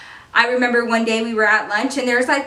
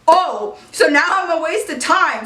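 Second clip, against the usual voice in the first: put-on offended boyfriend; 6 semitones higher, 3 dB louder than usual. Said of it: "let me get back into character".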